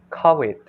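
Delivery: "covid" with a w in place of the v; Thai-style "covid", v turned to w; Thai pronunciation. Retold or imitated. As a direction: say "covid" as "co-wid", imitating a Thai speaker. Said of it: "she pronounced".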